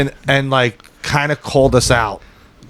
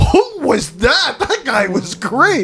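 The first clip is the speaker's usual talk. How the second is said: gushing; 10 semitones higher, slower than usual. Retold or imitated. Mother and sister rush in.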